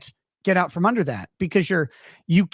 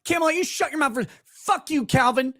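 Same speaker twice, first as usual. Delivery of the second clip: mock furious rant; 10 semitones higher than usual. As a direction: yell at Calvin in fake fury.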